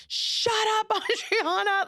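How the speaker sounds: high mock heckle with a laugh; heckler shout. Audience member heckling Adriana, laughing high mock shout. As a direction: yell it from the crowd, half laughing.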